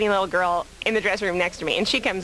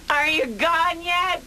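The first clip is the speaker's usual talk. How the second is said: high, drawn-out mock call; calling through door; 10 semitones higher, much slower than usual. Debtor checking on creditors outside, high drawn-out acted call. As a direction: stretch it out to reach through a door.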